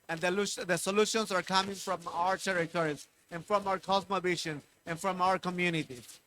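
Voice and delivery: ordinary talk, nothing marked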